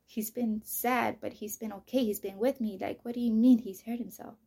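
loud, insistent protest; losing it. Retold or imitated. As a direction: argue back in pained disbelief. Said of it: "I started losing my shit".

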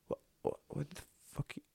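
whispered disbelief rising to high, incredulous squeaks; stunned disbelief. Retold or imitated. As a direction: rebuild the shock from a hushed gasp to a squeak. Retold shock at the news.

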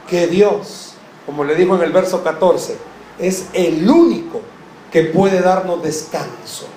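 ordinary delivery

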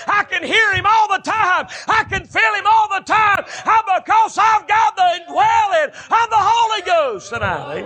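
rising fervent sermon shouts; preaching climax. Acted. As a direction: whoop it up as the sermon peaks.

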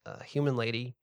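ordinary talk, nothing marked

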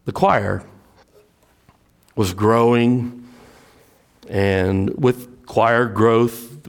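ordinary talk, nothing marked

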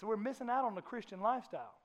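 normal talking voice

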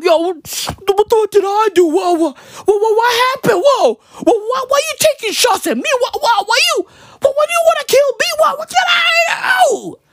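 high, stuttering confusion rising to a squealed wail. Retold or imitated. Comic as rapper in meme protesting shots, bewildered squeal. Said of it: "acting like he just woke up".

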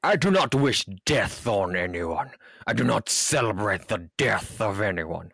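pompous official announcement, hammed-up; mock professional statement. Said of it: "Yes, professional".

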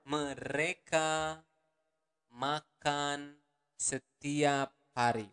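mereka makan setiap hari drawn out slowly; slow it right down. Shown as a demonstration. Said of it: "One more time, slower".

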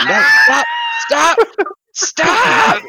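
high, loud panicked shouts; shouting stop. Retold or imitated. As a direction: yell at the dog to stop. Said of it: "Parker just shouting".

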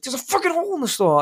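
high-pitched exasperated outburst; flustered. Retold or imitated. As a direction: squeal it in mock agitation. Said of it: "now I'm all flustered".

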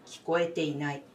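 ordinary talk, nothing marked

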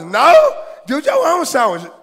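loud, high mock snap refusing; mock indignant refusal. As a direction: brush off the husband's request, indignant. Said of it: "Baby, can you go grab".

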